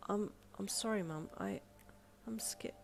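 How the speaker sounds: soft, stammering fear; scared. A narrator's reading. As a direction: stutter it out, frightened. Child scared before angry mother.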